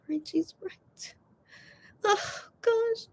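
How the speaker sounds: quiet crying. Real, not acted. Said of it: "it's hard to talk when I'm crying".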